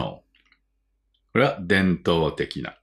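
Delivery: ordinary talk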